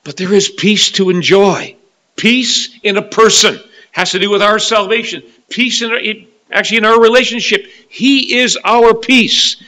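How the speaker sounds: loud, high preaching cry; preaching at full force. Acted. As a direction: proclaim it to the whole church.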